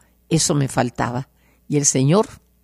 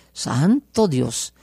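normal talking voice

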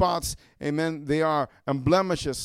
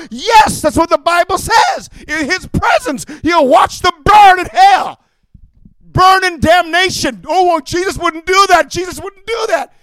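higher, louder fiery preaching; preaching at full force; 6 semitones higher, 6 dB louder than usual. Acted. Preacher warning the congregation of hellfire, shouting. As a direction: thunder it from the pulpit.

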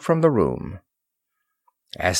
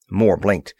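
ordinary delivery

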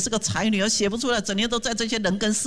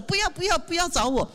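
high, protesting refusal; imitating a reluctant volunteer; 9 semitones higher than usual. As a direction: wave off the request in a high mock whine. (模仿推辞的志工，高声连连说不要)

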